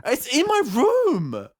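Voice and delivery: high-pitched, loud incredulous retort; comic outburst of disbelief, pushed high; exasperated yelling. Retold or imitated. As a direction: act out yelling the obvious at mom. Retold outburst at mother.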